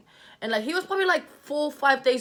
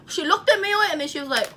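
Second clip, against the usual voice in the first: usual speaking voice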